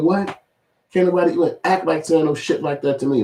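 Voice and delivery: ordinary delivery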